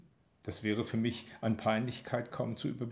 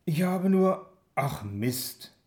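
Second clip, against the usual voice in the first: voice breaking; 5 semitones higher, 5 dB louder, slower than usual. A narrator's reading. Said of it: "meine Stimme bricht".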